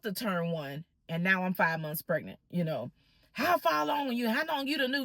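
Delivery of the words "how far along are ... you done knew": high-pitched imitated interrogation; retold indignant questioning, pitched up; angry mother. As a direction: imitate an irate mother demanding answers. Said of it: "she was so angry".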